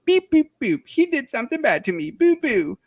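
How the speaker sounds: high, whiny mocking voice; squeaky voice mocking a complainer; whiny young person. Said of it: "young people want to".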